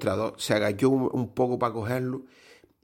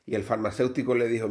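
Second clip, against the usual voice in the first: ordinary talk, nothing marked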